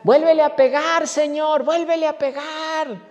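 high, loud cry to the Lord; excited yell. Retold or imitated. Deacon cries out to God to hit the rich man again, high loud yell. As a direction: yell it up to heaven, high and eager. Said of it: "un diácono empezó a gritar".

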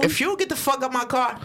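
indignant retort. Retold or imitated. Imagined retort to rude passenger.

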